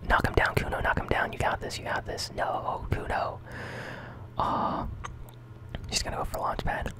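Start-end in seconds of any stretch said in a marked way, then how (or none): none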